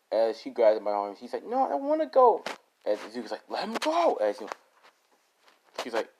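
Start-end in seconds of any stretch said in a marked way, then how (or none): 1.4-2.4 put-on high, louder child's cry of protest; a little boy resisting being dragged off; boy pulled by the arm, refusing to go. Child dragged away by villain. 3.5-4.1 pitched high and pressing; urgent demand. Acted. Hero demanding villain release child.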